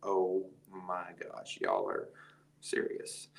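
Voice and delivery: slow, hushed, fading to a whisper; awed disbelief. Retold or imitated. Hearing the event is already booked, slow awed hush. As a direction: let it sink in, trailing off. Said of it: "And I'm like".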